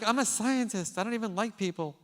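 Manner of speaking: high, loud, fast defensive outburst; comic panic, rattling off excuses; flustered protest. Retold or imitated. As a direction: speaker dodging the superintendent's question, loud and high. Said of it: "I'm like".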